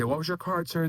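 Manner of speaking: high-pitched mock-performance question; showman's question. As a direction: put the question high, as stage patter. Magician acting out patter to spectator.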